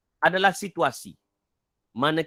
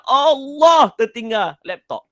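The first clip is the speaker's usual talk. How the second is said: higher and louder, drawn-out groan of dismay; dismayed realization; 6 semitones higher, 6 dB louder, slower than usual. Retold or imitated. Father at the office finds the laptop left behind, dismayed. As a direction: stretch it out like a sinking realization.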